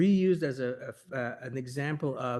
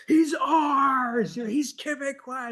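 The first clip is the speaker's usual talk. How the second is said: much higher and louder claim; possessive shout; 12 semitones higher, 8 dB louder, slower than usual. Retold or imitated. Quebec poets to Ginsberg, much higher and louder. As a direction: stake a fierce claim. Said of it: "the Quebec poets were like".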